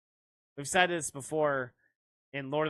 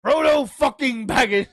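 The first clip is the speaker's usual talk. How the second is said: high, very loud name call; mock film shout; 8 semitones higher, 11 dB louder than usual. Joker calling out Frodo with a swear, mock shout. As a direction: bellow the full name, hammed-up.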